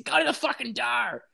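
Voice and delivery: high, put-on mom voice barking an order; mock the mom shouting him to the door; angry mom yelling. Mimicking his angry mom sending him to the door at night.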